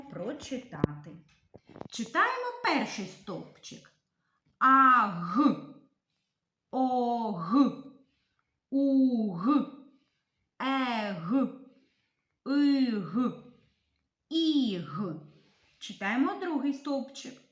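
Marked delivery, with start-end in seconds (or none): 4.6-15.1 put-on loud, slow, clear letter-by-letter reading for children; syllable drill. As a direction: chant the letters so the class can follow. Reads letter column aloud. (голосне чітке читання літер для дітей)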